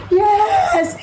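short high-pitched cried-out yes; retold scream. Missionary saying yes to Mozambique, retold scream. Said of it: "way too hard to ever scream yes".

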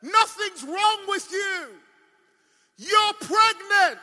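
high, slow, loud pronouncement; preacher's proclamation. Retold or imitated. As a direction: proclaim the diagnosis from the pulpit. Preacher proclaims doctor's verdict.

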